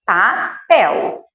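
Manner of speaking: "papel" as pa, pause, pel; two evenly spaced syllables in "papel"; separado em sílabas. Shown as a demonstration. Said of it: "agora sim". ("papel" com pausa entre as sílabas)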